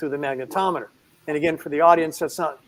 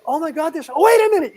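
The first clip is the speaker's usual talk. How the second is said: much higher, louder double take; sudden alarm; 12 semitones higher, 7 dB louder, faster than usual. Retold or imitated. Cabin attendant realising animals are on board, high startled double take. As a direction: catch yourself in shock.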